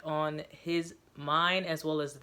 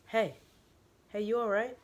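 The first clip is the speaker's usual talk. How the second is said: higher, louder caring check-in; concerned voice; 6 semitones higher, 3 dB louder than usual. A narrator's reading. Stranger checking on Joseph, concerned call. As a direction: call him back gently but urgently. An indistinct voice asks.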